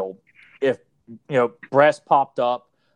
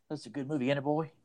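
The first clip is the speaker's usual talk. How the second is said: casual dad aside; 10 dB quieter, faster than usual. Acted. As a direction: murmur it like a contented dad to his son. Voices dad enjoying gory film.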